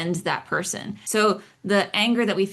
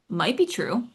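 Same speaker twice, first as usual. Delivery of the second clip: ordinary talk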